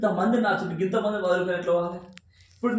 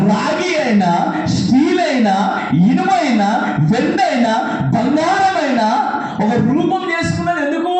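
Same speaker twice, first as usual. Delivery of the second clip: loud, high, driving sermon cadence; fiery preaching; 7 semitones higher, 7 dB louder than usual. Acted. Preacher rousing the congregation about being used, fiery preaching. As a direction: preach it out to the back rows.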